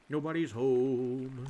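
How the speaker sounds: NOBODY'S HOME stretched slowly on a level tone; drawn out. Real, not acted. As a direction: hold the vowel in HOME long. Repeats it drawn out to self.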